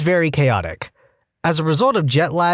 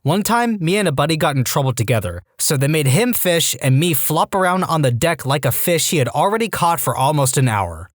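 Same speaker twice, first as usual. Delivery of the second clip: ordinary delivery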